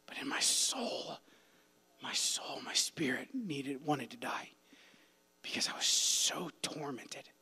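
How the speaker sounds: hushed whisper, slow on most lines. Real, not acted. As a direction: breathe the words out in a tormented whisper. Recalling spiritual torment.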